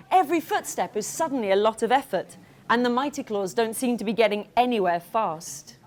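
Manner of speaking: ordinary delivery, nothing marked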